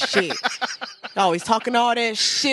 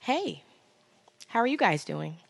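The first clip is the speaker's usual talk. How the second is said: polite, softer professional reading voice; customer service voice; 7 dB quieter than usual. Shown as a demonstration. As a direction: read it like a work phone greeting. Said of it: "It's my work voice".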